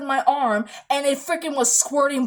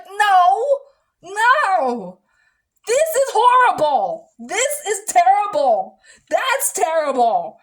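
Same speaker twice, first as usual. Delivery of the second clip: higher and louder, upset and pleading; distressed outcry; 6 semitones higher, 5 dB louder, slower than usual. Real, not acted. Refusing to return for another blood draw, a loud upset outcry. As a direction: protest as if it's happening again.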